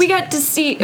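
thud as the microphone is hit; words over a mic knock; mic bump. Real, not acted. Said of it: "I just hit the microphone".

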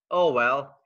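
ordinary talk

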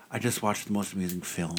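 hushed, low imitation of a film snob; mock-pretentious, lightly done; pretentious friend. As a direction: play the self-important cinephile softly. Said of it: "That's so pretentious".

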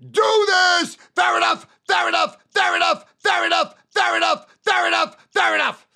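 loud, high listen do this fair enough; shouted pitch. Retold or imitated. Salesman performing the full pitch, shouting. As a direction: hammer the three words. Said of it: "So it's".

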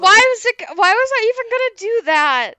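high, loud, exasperated question; loud protest. Real, not acted. Podcaster questioning the old accent promise, loud protest. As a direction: protest it loudly, pitch up high.

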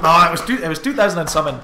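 sudden loud insistence; loud correction. Real, not acted. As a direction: blurt it out loudly. Loud correction after long pause.

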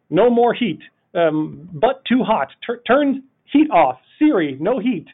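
hammed-up voice commands, slowed on turn heat off; mock commands to car. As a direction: talk to the car like it's slow.

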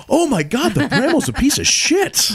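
high, slow, stunned disbelief; astonished. Retold or imitated. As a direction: stretch it out in shock. Retold friend after the ride.

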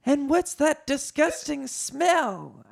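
high, drawn-out disgusted question; high character voice full of disgust. A narrator's reading. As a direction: recoil from a stink, stretching the words. Leia disgusted by the smell.

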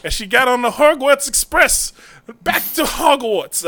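high, loud theatrical proclamation; hammed-up storybook send-off; grand mock announcement. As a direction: proclaim it grandly, slowing at the end. Jokingly announces intern's trip to Hogwarts.

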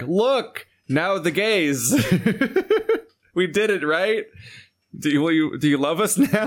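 high, hammy corporate plea; needy studio begging for approval; mocking company voice. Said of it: "they're now like".

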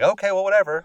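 high, loud, put-on "whatever"; mock dismissive. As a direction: brush them off in an exaggerated voice. Retold argument, giving in huffily.

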